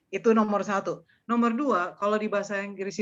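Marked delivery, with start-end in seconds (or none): none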